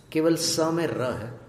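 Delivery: usual speaking voice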